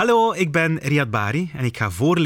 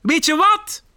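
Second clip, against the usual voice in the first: high, raised eager call; 4 dB louder than usual. Acted. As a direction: call out a sudden idea. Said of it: "roept hij". (hoog en enthousiast roepend)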